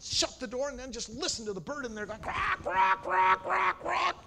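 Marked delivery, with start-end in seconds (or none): 2.2-4.2 loud high panicked quacks; frantic parrot squawks. Acted. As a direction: squawk like a bird trapped in the cold. Bird squawking inside freezer.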